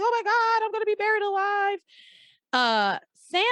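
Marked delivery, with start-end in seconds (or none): 0.0-1.8 high, rushed mock panic; mock panic about being buried alive; panicked inner voice. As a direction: imitate a woman waking up in terror, half played. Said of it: "her consciousness goes crazy".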